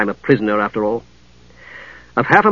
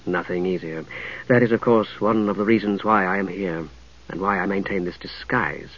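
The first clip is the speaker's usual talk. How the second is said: low, cultured woman's voice; 5 semitones lower, 9 dB quieter than usual. A narrator's reading. Catherine explains disguise.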